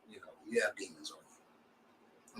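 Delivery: very soft, slow and low; hushed, drawn-out aside; understated verdict. Real, not acted. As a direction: let it drop away almost under your breath. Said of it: "I'm gonna say it straight like that".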